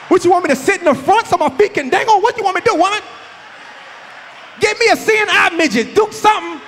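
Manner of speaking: hammed-up squabble between old parents; mock old-couple bickering. Parents quarrelling in store.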